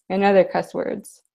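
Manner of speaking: usual speaking voice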